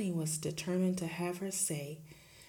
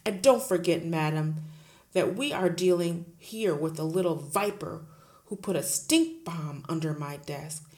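loud, booming headmistress; bully the teacher at full volume; Trunchbull bullying; 8 dB louder than usual. Acted. Headmistress roaring at Miss Honey, loud and bullying.

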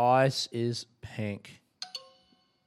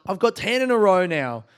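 ordinary talk, nothing marked

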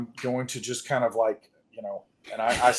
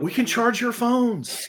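high, drawn-out glee; thrilled and stretched out; excited insistence; 9 semitones higher than usual. Acted. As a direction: pipe it up high, still delighted. Repeats the delighted discovery.

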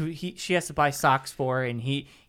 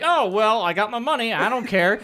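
careless; 7 semitones higher, 6 dB louder than usual. Retold or imitated. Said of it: "he's just like".

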